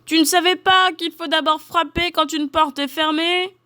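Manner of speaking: high-pitched scolding bear call; big bear shouting. A narrator's reading. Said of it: "de sa grosse voix, lui crie".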